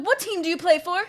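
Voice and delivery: playful grilling; mock demanding coach. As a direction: demand an answer, imitating a movie coach. Quoting coach's challenge to player.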